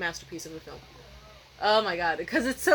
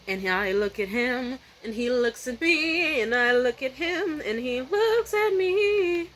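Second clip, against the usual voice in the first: [name] style; 2 semitones higher, slower than usual. Retold or imitated. Quoting [name]'s song to tell the stare-down, slow and dramatic. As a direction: drag each phrase out like a soap opera song. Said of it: "[name] reference".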